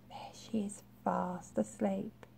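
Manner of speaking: hushed whisper so as not to wake her; quiet bedtime aside. A narrator's reading. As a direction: whisper it softly. Reader shows sleeping caterpillar quietly.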